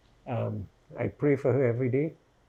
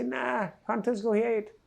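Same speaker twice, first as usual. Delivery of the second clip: imitating attacker; 8 semitones higher than usual. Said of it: "And they say".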